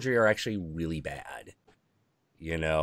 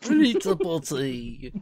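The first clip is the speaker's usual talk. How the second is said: Wacko voice; 7 semitones higher, slower than usual. Retold or imitated. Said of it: "you can be Wacko from the Animaniacs".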